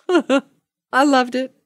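ordinary talk

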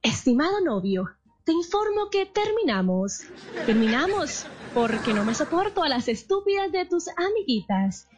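sugary cashier tone hiding anger; polite cashier voice. Pretend. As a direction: break up like a store announcement. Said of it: "ese tono de voz para una pelea".

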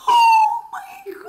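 very high, drawn-out imitated scream; screamed greeting. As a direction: shriek it slowly like an overwhelmed friend. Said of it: "she saw a waiter and she screamed".

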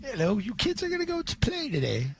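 high, soft mock mom voice calling the kids; mock Giselle voice. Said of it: "Does she talk like that now".

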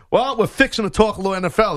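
hammed-up Southern folksiness, rattled off. Shown as a demonstration. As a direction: put on a lively Southern accent. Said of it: "There's a little Southern for you".

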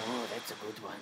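normal talking voice

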